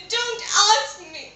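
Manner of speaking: high, slow sobbing refusal; weeping queen. Acted. Queen answers the maid's question, weeping. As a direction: sob through it, acted and drawn out. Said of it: "The queen continued weeping".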